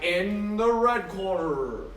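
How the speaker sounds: slow, drawn-out ring announcer call; boxing announcer. Retold or imitated. Streamer mimics the boxing announcer for chat, slow and drawn out. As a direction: stretch it out like a ring announcer introducing a fighter. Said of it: "when they're like".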